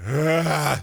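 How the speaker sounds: a big acted exasperated groan. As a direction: groan out loud in mock frustration. Acting out exasperated reaction to others.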